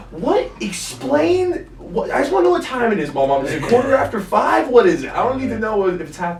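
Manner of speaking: put-on high, insistent whiny child; pestering kid. Kid pestering grandma, high and whiny. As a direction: nag like a bored kid.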